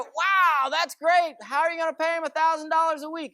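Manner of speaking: high, loud astonished wow; amazed friends. Retold or imitated. Friends amazed at the man's deal, high and loud. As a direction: gasp in disbelief at the news. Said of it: "they go".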